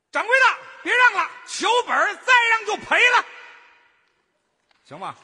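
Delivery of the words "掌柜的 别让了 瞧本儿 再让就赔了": straining, very high and loud cry; very high shout. Shown as a demonstration. Helper obeys demand for more pitch, very high shout. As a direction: shriek the vendor's line at full volume. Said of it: "还可以再高一点".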